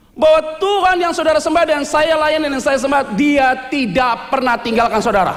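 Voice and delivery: slow, loud pulpit proclamation; preacher declaring God's faithfulness, slow and loud; forceful preaching. Acted. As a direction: drive the promise home to the church. Preaches to the congregation.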